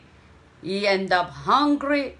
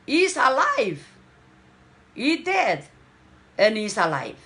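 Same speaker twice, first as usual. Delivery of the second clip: joyful father's cry; 3 semitones higher than usual. Acted.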